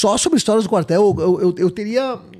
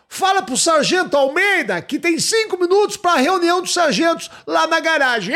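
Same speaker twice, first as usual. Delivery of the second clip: mock sergeant order; 8 semitones higher than usual. Sargento dando ordem.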